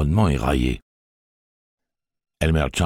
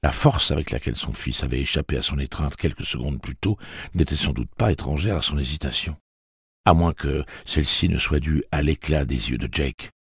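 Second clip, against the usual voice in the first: ordinary delivery, nothing marked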